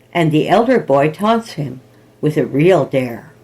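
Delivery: plain reading voice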